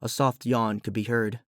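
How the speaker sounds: plain reading voice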